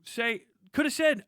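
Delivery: ordinary talk, nothing marked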